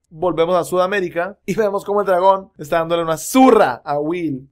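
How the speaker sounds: normal talking voice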